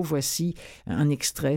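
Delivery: ordinary delivery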